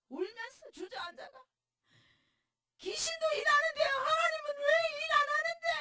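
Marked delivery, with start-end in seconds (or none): 2.8-5.7 high, loud sobbing cry; retelling her tearful protest to God, high and loud. Retold or imitated. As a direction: wail the complaint up at God through tears. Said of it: "하면서 내가 막 이렇게 울었다니까".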